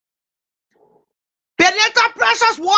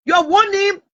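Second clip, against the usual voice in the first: usual speaking voice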